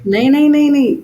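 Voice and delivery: loud, high, rapid-fire denial; emphatic protest. Real, not acted. Quickly correcting co-host's joking misreading. (ज़ोर से टोकते हुए इनकार)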